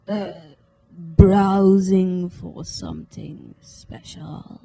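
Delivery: slow, raspy creepy shopkeeper; raspy slow old man. Acted. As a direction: draw it out like grinding cogs. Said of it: "raspy and slow".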